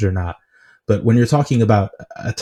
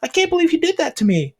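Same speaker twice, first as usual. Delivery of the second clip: mock whine, very high-pitched; Norris whining; 4 dB louder than usual. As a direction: imitate a wronged driver complaining in a high, whiny voice. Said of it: "like, whining".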